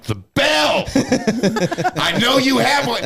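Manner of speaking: hoog en luider, smekend om de bel; high drunk plea. Retold or imitated. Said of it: "I'm like".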